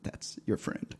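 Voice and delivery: whispered aside. Real, not acted. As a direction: drop to a whisper. Whispered punchline.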